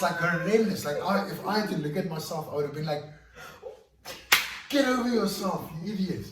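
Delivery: high, loud, laughing self-mockery; laughing at himself. Real, not acted. Mocking his crying self to the host, high and loud with laughter. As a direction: scoff at your past self through a laugh.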